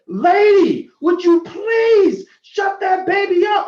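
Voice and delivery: loud, high, drawn-out shout; angry yell. Retold or imitated. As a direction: imitate an upset passenger yelling across the aisle. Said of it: "So he yells at this woman".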